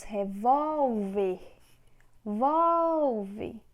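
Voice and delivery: reVÓLver, VÓL stretched long and loud; stress on middle syllable. Shown as a demonstration. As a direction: call out revólver, then repeat Volve with a big swell. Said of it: "a sílaba tônica".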